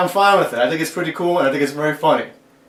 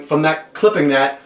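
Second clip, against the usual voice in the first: ordinary talk, nothing marked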